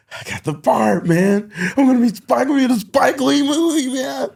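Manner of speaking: high, loud retold excitement; hammed-up elation. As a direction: crow it high, mocking your own premature joy. Retold thrill at getting the part.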